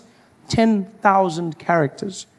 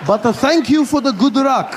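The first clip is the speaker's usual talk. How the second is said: put-on Japanese man accent; 5 semitones higher than usual. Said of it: "I was like".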